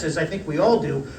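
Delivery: normal talking voice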